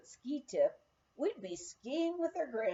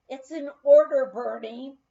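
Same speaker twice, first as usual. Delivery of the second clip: firm, much louder command; emphatic order; 3 semitones higher, 13 dB louder than usual. Retold or imitated. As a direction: lay down the law to the boy. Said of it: "I emphatically stated".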